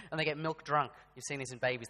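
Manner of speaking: ordinary delivery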